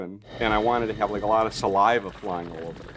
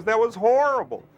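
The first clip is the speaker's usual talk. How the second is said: imitated high-pitched cry of dismay; director John's outburst; 14 semitones higher, 4 dB louder than usual. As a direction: play an appalled director, voice pushed way up. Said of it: "You know, like that".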